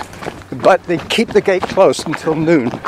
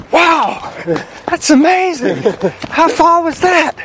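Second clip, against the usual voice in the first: high, gushing mock awe; mock gushing friend; 11 semitones higher, slower than usual. Mimicking friends gushing over a slow marathon. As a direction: gush like a clueless impressed friend. Mocking impressed non-running friends.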